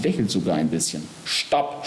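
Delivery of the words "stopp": high, abrupt mock yell; shouted stop. A narrator's reading. Death interrupting Geist's flattery of Body, mock shout. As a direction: halt them without real volume. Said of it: "schreit der Tod".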